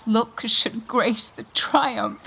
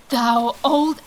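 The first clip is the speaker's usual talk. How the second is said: ordinary talk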